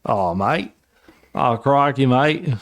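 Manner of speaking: mock Australian, rising on mate; lightly hammed Aussie; Australian accent. Cohost joking about Australian listeners, mock Aussie accent. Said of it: "if we're gonna speak Australian".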